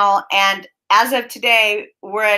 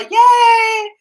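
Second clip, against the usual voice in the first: excited cheer; 13 semitones higher, 6 dB louder than usual. Real, not acted. Viewers join from abroad.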